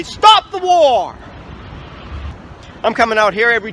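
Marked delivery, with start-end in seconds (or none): 0.0-1.1 loud, high, drawn-out rallying cry; protest shout. Real, not acted. Protester at the Federal Building, loud drawn-out shout. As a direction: shout it slowly at the whole building.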